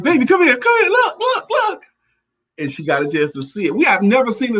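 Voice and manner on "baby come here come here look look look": very high, urgent "come here, look, look"; calling his wife over in a rush to see the snake; excited call. Retold or imitated. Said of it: "I'm like".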